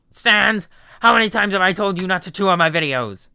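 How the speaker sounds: exasperated skeleton yell; Papyrus scolding. Acted. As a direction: tell off the brother. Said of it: "Papyrus voice".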